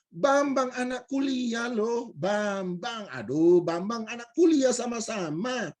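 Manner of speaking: high, slow, stretched-out reminder; exasperated insistence. Retold or imitated. Stranger claiming they studied together, exasperated. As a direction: imitate someone amazed at being forgotten.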